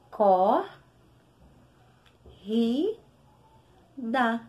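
co, ri, da spaced out syllable by syllable; split into syllables. Shown as a demonstration. Teacher separates corrida into syllables. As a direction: pause between co, ri and da. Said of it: "um R foi pra um lado".

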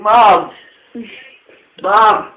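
shouting. Real, not acted. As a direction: yell for mom. Calling out to mom.

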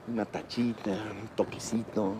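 low, soft, slowing suggestion; mock-confiding coaxing. Real, not acted. Director probing what the actor took, coaxing softly. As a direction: coax it out quietly, drawing it out.

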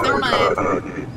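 ordinary talk, nothing marked

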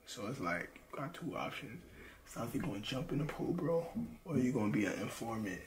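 hushed, unvoiced interrogation; whispering. Real, not acted. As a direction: whisper the threat close up. Interrogating a found player.

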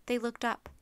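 usual speaking voice

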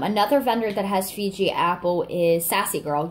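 ordinary delivery

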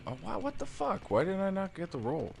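sudden high shocked outburst; surprised. Real, not acted. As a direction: caught off guard, yelping in disbelief. Surprised by sudden hit in game.

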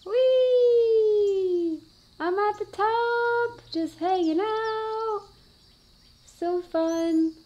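high, bright glee at the top; gleeful high voice. Pretend. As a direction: squeal with delight from the top. Toy reaches top of dome.

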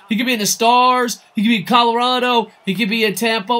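rising fanboy excitement; excited hype. Real, not acted. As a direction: cheer on the favorite player whatever his jersey. Hyping favorite player.

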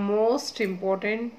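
most important drawn out very slowly, each word weighted; slow emphasis. Real, not acted. Teacher telling students the preamble matters most, dragged out slowly. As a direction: lean hard on most important, spacing it out.

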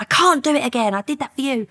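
high-pitched exasperated quote; retold angry protest. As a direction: act out his frustrated complaint, pitched well up. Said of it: "he was getting angry".

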